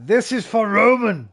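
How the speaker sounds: loud, high war cry; mock battle cry for a pirate raid. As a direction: yell it as you storm the ship. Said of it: "I want you to shout".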